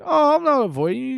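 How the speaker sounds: high-pitched imitated denial; mock denial. Acting out the avoider denying it to his friend, high mock protest.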